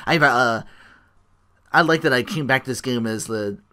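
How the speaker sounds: ordinary delivery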